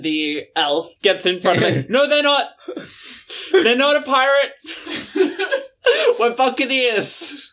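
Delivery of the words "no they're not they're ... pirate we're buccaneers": high, laughing correction; fussy elf navigator. Acted. Elf navigator correcting the pirate, high and giggly. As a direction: correct them primly, breaking into laughter.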